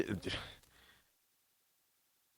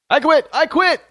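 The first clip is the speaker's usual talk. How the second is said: high, louder sing-song I quit; mock the president throwing up his hands; mock resignation; 9 semitones higher, 3 dB louder than usual. Mocking the college president as he quits, high and louder.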